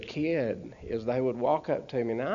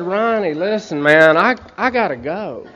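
pleading; 5 semitones higher, 9 dB louder than usual. Retold or imitated. Begging friend to leave bar.

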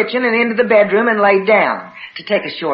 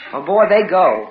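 usual speaking voice

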